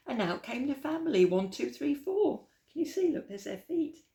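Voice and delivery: excited read-aloud. Acted. Narrator pointing at the picture for young listeners, excited and high. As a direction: build up the surprise for the kids. Family bursts out, excited reading.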